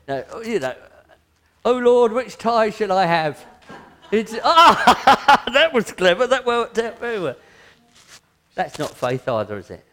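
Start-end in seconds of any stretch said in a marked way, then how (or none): 1.6-3.4 loud, much higher hammed-up plea; mock trivial prayer. Preacher mocking trivial prayers, loud high mock plea. 4.1-7.3 loud, much higher mock self-congratulation with a laugh; mock smug laugh. Preacher mocking a smug believer, loud laughing mock pride.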